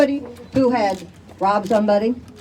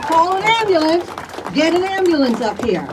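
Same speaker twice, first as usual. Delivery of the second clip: urgent shout; 5 semitones higher than usual. Retold or imitated. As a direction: yell for help over the crowd. Mother demanding help at scene.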